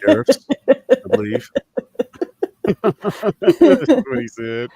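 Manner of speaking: real laughter spilling through the words; cracking up, laughing on every word. Real, not acted. Telling friends about the country of Mattel, cracking up.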